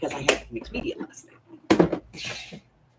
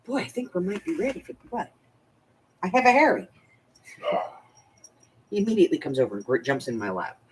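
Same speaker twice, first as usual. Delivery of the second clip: ordinary talk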